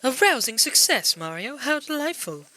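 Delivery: put-on high, delighted old-lady voice. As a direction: swoop up like a pleased old lady. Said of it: "that was the old lady talking".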